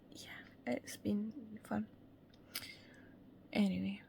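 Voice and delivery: soft unvoiced whisper. Real, not acted. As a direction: breathe it out, barely voiced. Late-night tired aside.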